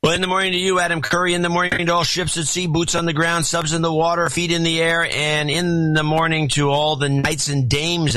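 high, sing-song announcer greeting; show greeting. Acted. Co-host saluting all the ships at sea, high announcer chant. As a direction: rattle off the salutation on one high note.

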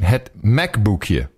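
slow "Het Mekboekje", with "book-yuh" at the end; demonstrated Dutch pronunciation. Shown as a demonstration. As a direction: model "Het Mekboekje" slowly, oo vowel then "kyuh". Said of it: "let me give you the pronunciation".

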